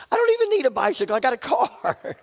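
high, fast, exasperated squeak; hammed-up disbelief at the temptation; mock indignant. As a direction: protest in a quick high-pitched outburst. Arguing back with inner voice.

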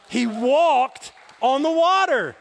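slow, high, loud shout; emphatic shout. Real, not acted. Preacher repeating to an unmoved congregation, shouting emphatically. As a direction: belt it out, stretching "walked". You obviously didn't hear what I said.

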